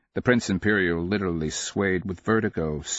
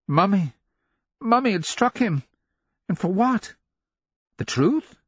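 put-on high-pitched voice of a hurt, incredulous boy; outraged child; 12 semitones higher, 3 dB louder than usual. A narrator's reading. Struck son reeling at his mother's slap, high and outraged. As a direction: let the boy's outrage lift the pitch right up. Outraged boy's thoughts after being slapped.

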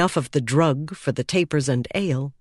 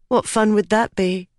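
higher, teasing challenge; playful dare in a raised pitch; challenging; 6 semitones higher than usual. A narrator's reading. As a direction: throw the question back, pitched up. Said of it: "she challenged".

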